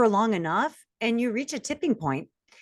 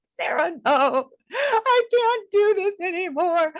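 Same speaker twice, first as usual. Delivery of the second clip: high, crying voice that slows at the end; reliving a crying breakdown, pitched up and teary; retold sobbing; 12 semitones higher, slower than usual. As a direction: break down in tears as you say it. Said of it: "all of a sudden I just start bawling".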